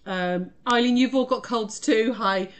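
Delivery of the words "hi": usual speaking voice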